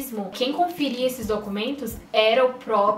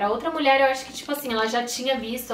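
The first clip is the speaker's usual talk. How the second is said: ordinary talk, nothing marked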